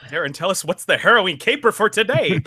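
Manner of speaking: hammed-up thirties serial presenter; mock radio announcer. Host asking co-host for today's caper, mock announcer.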